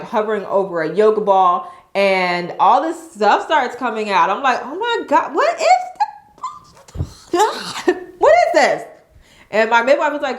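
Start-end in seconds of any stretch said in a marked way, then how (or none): 4.6-8.8 high squealed disbelief, breaking into laughter; mock horrified disbelief. Mom recounts birth mess, high-pitched laughing shock. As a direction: laughingly reenact being grossed out.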